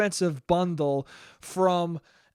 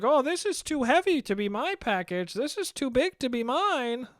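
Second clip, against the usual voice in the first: higher, softer imitation of a kindly old man; light old man voice with a soft, musing tone; sweet old man voice; 6 semitones higher, 4 dB quieter than usual. Imitating the old neighbour explaining why he took the box, sweet old man voice. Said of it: "Sweetest old man voice you ever heard".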